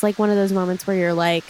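ordinary talk, nothing marked

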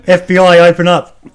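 loud mock agent banging on door; FBI raid shout. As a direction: bark it like a federal agent at the door. Joking FBI raid after "secret stash.

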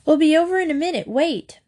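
fast, loud call across the street; quick loud shout. A narrator's reading. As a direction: call out to a girl down the road. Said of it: "Martha shouted".

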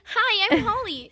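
high, bright fake phone greeting. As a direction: put on your polite phone voice. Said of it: "my voice gets so fake on the phone".

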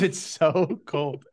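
slow, laughing it out; giggling disbelief at the cold; laughing through words. Real, not acted. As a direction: laugh through the last word, drawn out. Laughing at stinging ice cold.

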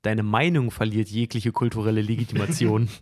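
low, soft, cutting put-down. Pretend. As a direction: deliver the insult low and cold. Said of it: "du musst ihn innerlich mehr angreifen".

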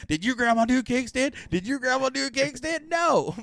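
high-pitched, boastful re-enacted yell; yelling at everybody. Bragging to everybody at the party, high yelling. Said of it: "I'm yelling at everybody at the party".

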